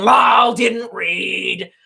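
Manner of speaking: mock shouting. Streamer voicing China's leader dismissing complaints, shouting. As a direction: shout it like a smug troll. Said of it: "he's going".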